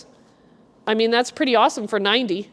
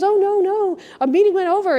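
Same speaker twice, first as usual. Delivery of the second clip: flustered apology; 9 semitones higher, 4 dB louder than usual. Retold or imitated. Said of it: "And he goes".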